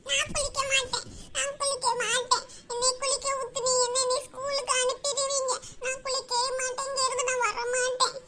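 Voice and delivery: put-on soft, whiny child's refusal; tearful sulky protest; crying child. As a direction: whimper stubbornly like a little girl. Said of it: "அழுது அழுது மூஞ்சி பூரா".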